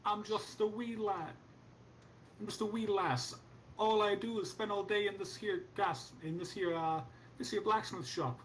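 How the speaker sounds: leprechaun. Acted. GM voicing the blacksmith lass.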